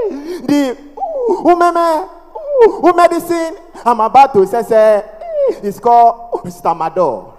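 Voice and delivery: stuck syllables repeated between long blocks; hammed-up stammering salesman; mock stammer. Stammerer selling medicine on the bus, heavy mock stammer. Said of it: "family members that stammer".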